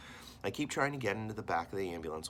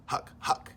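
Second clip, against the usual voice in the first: breathless voiceless choking huck; choking huck noise; 6 dB louder than usual. Acted. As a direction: gag on the air, no voice. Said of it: "he's making this huck".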